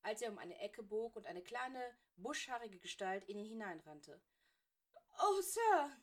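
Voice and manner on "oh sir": put-on high, loud frightened girl's voice; young Granger, breathless with alarm; frantic girl. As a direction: gasp it out as a panicked schoolgirl. Granger runs in, tearful.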